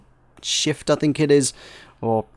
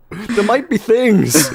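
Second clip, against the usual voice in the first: mock whine; 8 semitones higher, much slower than usual. Insisting to the dev.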